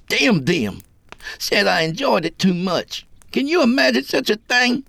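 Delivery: put-on high-pitched, worked-up kid; aggrieved young killer. A narrator's reading. Johnny protesting that his folks threw him out, aggrieved. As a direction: pitch it up, building in indignation.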